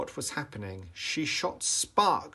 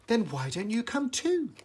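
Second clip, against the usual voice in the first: Dorothy inviting; 11 semitones higher than usual. A narrator's reading. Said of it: "asked Dorothy".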